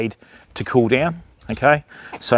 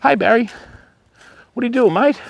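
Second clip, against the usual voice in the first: pet talk; 9 semitones higher, faster than usual. Real, not acted. Talking to Barry the cockatoo.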